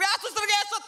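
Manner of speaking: high yelp of fright; mock scared cry. Bystander spotting suster ngesot, high mock fright. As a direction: play the spooked bystander. People scared seeing ghost.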